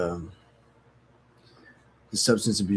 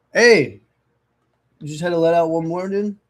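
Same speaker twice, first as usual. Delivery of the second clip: loud, higher exasperated call; scolding the dog; 6 semitones higher, 4 dB louder than usual. Real, not acted. Dog interrupts again, so a loud exasperated yell. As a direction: bark a sudden rebuke at the dog.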